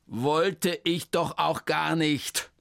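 desperate cry. Acted. Hermann cries out to the clerk, slow and desperate. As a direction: plead it out slowly in despair. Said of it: "rief Hermann verzweifelt".

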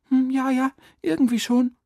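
put-on very high child's voice, owning up; sheepish boy. A narrator's reading. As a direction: admit it like a boy caught out. Ertappter Junge gibt es zu. (kleinlaute, hohe Jungenstimme)

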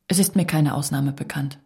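ordinary delivery

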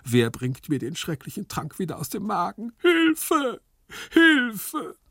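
high-pitched melodramatic wailing; anguished, hammed-up outburst; wailing lament. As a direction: lament wildly, rising to cries for help. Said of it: "jammerte Gumpellino".

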